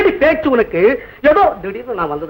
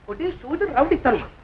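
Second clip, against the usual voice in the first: ordinary talk, nothing marked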